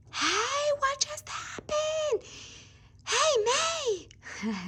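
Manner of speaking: high, startled little doll voice; squeaky doll voice. Pretend. Doll asks the girls what happened, high and startled. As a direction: play the doll waking up confused.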